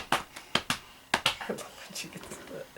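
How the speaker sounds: soft, voiceless whisper; whispered aside. Real, not acted. Aside while child performs.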